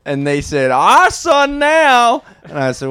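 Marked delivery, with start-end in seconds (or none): 0.7-2.2 high, loud, drawn-out mock yell; mock proclaiming holler; retold cry. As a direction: stretch it into a hammed-up declaration. Retold cry of people grabbing.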